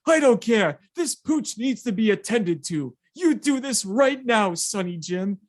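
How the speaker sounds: hammed-up hero barking orders at the hospital; lightly voiced indignant Babe Ruth; Babe Ruth demanding. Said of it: "It's literally what he said".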